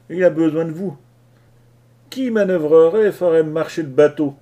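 loud, furious tirade at the crew; a sailor losing his temper, yelling at his cowards; angry outburst. Acted. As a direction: explode at them, shouting. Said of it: "il éclata".

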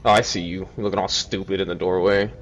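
ordinary talk, nothing marked

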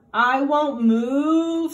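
very slow, loud, heavy cow voice; a slow, loud, stubborn cow refusing to budge; stubborn bossy cow. A narrator's reading. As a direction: plant your hooves and refuse like a stubborn cow. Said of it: "said Bossy Cow".

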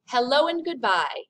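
ordinary talk

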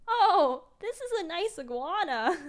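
high, loud uneasy niceness; nervous politeness. Retold or imitated. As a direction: feign delight while uneasy. Nervously holding an iguana.

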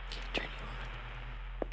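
ordinary talk, nothing marked

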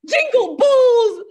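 usual speaking voice

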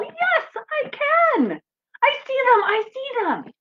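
put-on high, eager child mole voice; Little Mole crying out. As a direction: cry out like an excited little mole. Little Mole excited in story.

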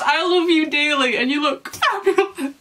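high, tearful wail, half laughing, half crying; real tears mixed with laughter, voice breaking high; laughing through tears. Real, not acted. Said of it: "I'm literally crying".

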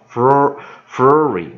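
floo then flooring, slow and loud; syllable demo. Shown as a demonstration. Teacher splitting flooring for students, slow and loud. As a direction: break flooring apart, then say it whole. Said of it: "第三个跟着念".

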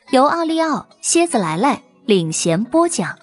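announcer. Acted. Credits read.

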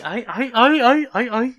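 high run of repeated "ay"; helpless fan yelps; overwhelmed whimpering. Real, not acted. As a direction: keep yelping, climbing in pitch. Overwhelmed by the preview.

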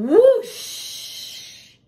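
high, loud whoosh. A narrator's reading. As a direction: let it rush past like wind. Sound effect word in story.

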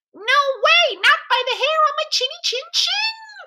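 very high, squeaky piglet refusal; little pig's cry. A narrator's reading. Second little pig refuses the wolf entry, crying out. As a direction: squeal it out like a defiant cartoon piglet. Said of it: "cried the second little pig".

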